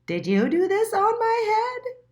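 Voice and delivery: high, loud squeaky question; a small indignant mole demanding answers; little mole voice. A narrator's reading. Little Mole asking the pigeon, high and indignant.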